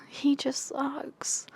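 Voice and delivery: "He just sucks" breathed out as a slow whisper. Real, not acted. Vlogger grumbling about the male lead, slow whisper. Repeated, second time whispered.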